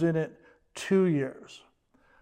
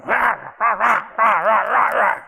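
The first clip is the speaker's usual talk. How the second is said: rah repeated higher and louder in a yappy bark; chihuahua yapping; 3 semitones higher, 5 dB louder than usual. Retold or imitated. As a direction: bark each rah like a tiny dog. Imitating two chihuahuas barking.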